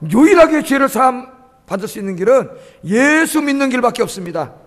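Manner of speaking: forceful sermon delivery, key words punched; emphatic preaching. Real, not acted. Preacher warns congregation that only faith saves, emphatic preaching.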